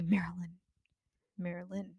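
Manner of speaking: quiet, cross whispered "Marilyn"; "Marilyn" hissed under the breath when annoyed; mad whisper. Shown as a demonstration. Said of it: "when I'm mad at you".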